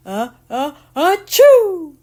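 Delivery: building up to a loud sneeze; uh, uh, then explode into achoo; big sneeze. A narrator's reading. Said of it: "Mom nodded glumly and sneezed".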